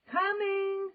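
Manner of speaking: loud high reply; shouting. A narrator's reading. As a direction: shout back. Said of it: "she shouted".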